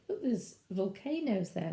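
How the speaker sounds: ordinary delivery, nothing marked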